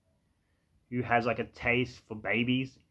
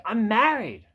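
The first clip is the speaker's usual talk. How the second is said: acted quote; 9 semitones higher, 5 dB louder, slower than usual. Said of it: "she's like".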